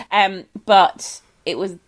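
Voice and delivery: ordinary talk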